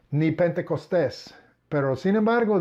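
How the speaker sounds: usual speaking voice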